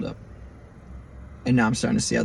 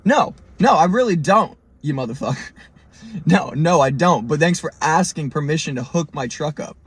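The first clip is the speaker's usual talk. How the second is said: imagined telling-off, heated and emphatic; retold indignant outburst; 2 semitones higher than usual. Driver to tow guys hooking up his truck, indignant. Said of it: "I'm not talking like this".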